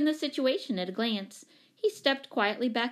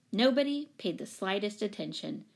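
plain reading voice

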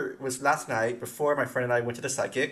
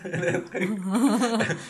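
normal talking voice

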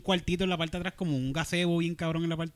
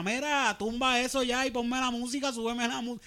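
mock heckler yell; 7 semitones higher than usual. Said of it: "le está gritando".

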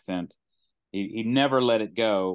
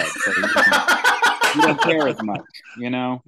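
laughing; 2 semitones higher, 7 dB louder, slower than usual. Real, not acted. Comic riffing on the director's stepkid note, laughing. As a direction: crack up while making the joke. Joking, cracking up at own riff.

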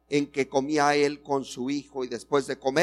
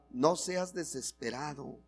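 softly to your neighbour; 9 dB quieter than usual. Shown as a demonstration. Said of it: "dile al que está a tu lado".

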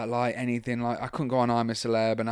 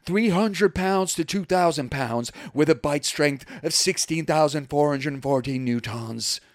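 big mock ring announcer voice; 3 semitones higher, 5 dB louder than usual. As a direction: announce a contender entering the ring. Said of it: "future as a ring announcer".

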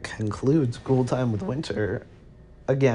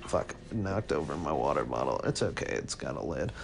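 muttered aside; 8 semitones lower, 9 dB quieter than usual. Real, not acted. Aside after knocking over bottle.

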